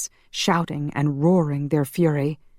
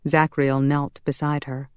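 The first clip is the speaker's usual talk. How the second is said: usual speaking voice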